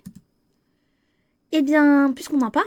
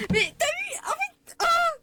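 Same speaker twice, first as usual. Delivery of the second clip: very high, giddy squealing; excited squeal; 4 dB quieter, much slower than usual. Real, not acted. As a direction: squeal over what just happened on screen. Squealing at game scene.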